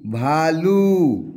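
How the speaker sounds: भालू stretched very slowly over both syllables; blended slowly. Shown as a demonstration. Teacher spelling out a word for pupils, very slow drawl. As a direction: draw out भालू so children follow. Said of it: "भ अकार भा".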